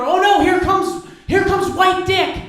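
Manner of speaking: high, loud schoolyard jeer, dragged out; mock dread taunt. Kids at school mocking him, high loud jeering. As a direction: jeer like a bratty kid spotting him.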